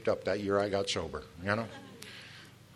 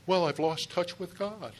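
higher, whiny put-on voice; mock complaining newcomer; 6 semitones higher, slower than usual. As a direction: lightly act out a moping newcomer.

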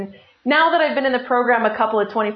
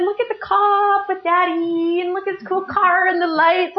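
put-on high, childlike squeal of wonder; excited kids imitation; 7 semitones higher than usual. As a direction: imitate thrilled children pointing things out. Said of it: "my kids are so excited".

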